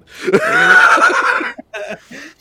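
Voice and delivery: a long, loud, high burst of laughter. Real, not acted. Laughing hard after the dwarf's answer. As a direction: howl at the punchline. Host cracks up at punchline.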